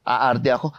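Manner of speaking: normal talking voice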